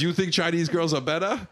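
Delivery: lightly put-on Chinese accent, teasing; terrible fake accent. Bond girl to Bond, a mock Chinese accent, terribly done. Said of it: "In a terrible accent".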